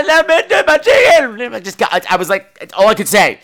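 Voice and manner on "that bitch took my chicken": upset wail. Retold or imitated. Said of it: "And I said".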